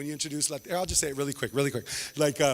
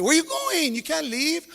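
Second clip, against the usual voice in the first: pleading host; 7 semitones higher than usual. Retold or imitated. Host begging the parents to stay, high pleading. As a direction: beg the crowd to stay put, pitched up.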